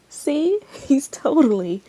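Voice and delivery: high, loud laughing taunt; gleeful mocking laugh. Aino gloating to the group, laughing taunt. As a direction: laugh in triumph at him. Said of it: "Aino laughed in glee".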